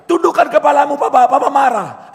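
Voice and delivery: loud, high urgent warning; loud, high brother hissing a warning; big brother warning. Retold or imitated. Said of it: "Abang bilang".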